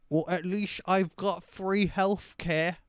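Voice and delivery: high, slow, loud mock-British voice; dumb British accent. Host mocking British people for laughs, dumb British accent. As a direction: play a slow-witted Brit, hammed-up. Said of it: "dumb, stupid British person just like".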